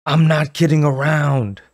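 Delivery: harsh, rasping insistence; harsh raspy voice. Acted. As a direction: rasp it out. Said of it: "in her harsh, raspy voice".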